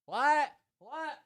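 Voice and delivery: very high, quiet mock "what?"; confused wife. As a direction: imitate her in a tiny high-pitched voice. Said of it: "She would go".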